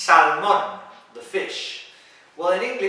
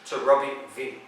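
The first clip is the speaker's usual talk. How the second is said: ordinary delivery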